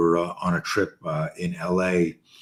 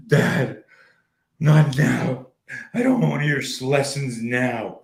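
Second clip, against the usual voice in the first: put-on raised-pitch child's plea, drawn out at the end; imitated tearful protest; 4 semitones higher, 4 dB louder, slower than usual. Crying son refusing dad's lesson, mimicked wail. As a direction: imitate an upset little boy pleading. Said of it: "he's wailing like".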